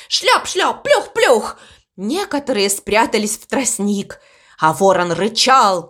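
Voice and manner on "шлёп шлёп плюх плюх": high-pitched playful splashes; high storybook sound effects; splash noises. A narrator's reading. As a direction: imitate ducks plopping into water. Imitating ducks splashing into water.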